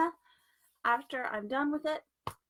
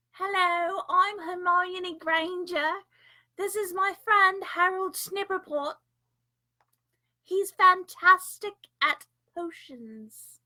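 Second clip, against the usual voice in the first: high, loud mock-British schoolfriend introduction; Hermione Granger; 6 semitones higher, 8 dB louder, slower than usual. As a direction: put on a British accent, pitched up and projected, stretching words. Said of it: "It sounds like something from, uh, Harry Potter".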